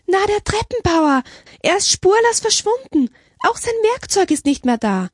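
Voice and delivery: very high, upset reporting; agitated. A narrator's reading. Sister telling the abbess the stair builder vanished, agitated. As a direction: blurt it out in high-pitched agitation. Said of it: "erklärte Schwester Mary Ann aufgebracht".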